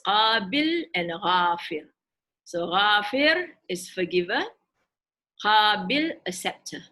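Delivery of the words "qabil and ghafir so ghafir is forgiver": ordinary talk, nothing marked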